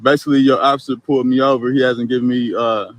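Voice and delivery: speaking up. Real, not acted. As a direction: raise your voice over the road. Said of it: "can you speak up then".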